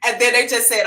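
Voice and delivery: ordinary talk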